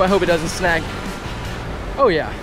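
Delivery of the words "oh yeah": ordinary talk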